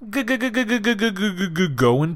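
high, comic stuttering lead-in; mock stutter. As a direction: stammer for the bit. Hammed stutter announcing.

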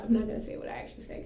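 ordinary talk, nothing marked

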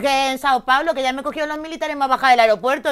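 high, loud protest; loud self-defence. Real, not acted. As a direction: defend yourself at full volume over the others. Defends herself after being teased.

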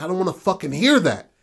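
put-on furious teen lashing out; angry outburst. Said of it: "he was just like".

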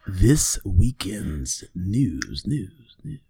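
slow dramatic announcer echo. Acted. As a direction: announce the news, slowly. Dramatic news intro echo.

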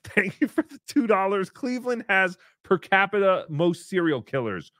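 normal talking voice

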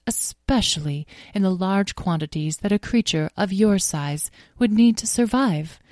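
gracious pony, sympathetic. Acted. Starfire explaining grass digestion to Azrael, gracious and formal. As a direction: explain kindly and with great formality.

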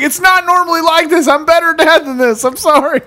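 high, sheepish apologizing voice; a new dad excusing himself to his buddy, high and sheepish; flustered new dad. Retold or imitated. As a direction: squeak out an embarrassed excuse. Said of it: "he was just like".

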